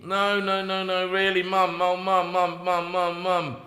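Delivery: lightly voiced whiny kid chanting mum; whining child. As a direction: pester mum like a small child.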